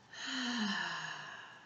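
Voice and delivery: long breathy sigh out; slow, audible exhale. Shown as a demonstration. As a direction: release a held breath in a sigh. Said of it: "Sigh it out".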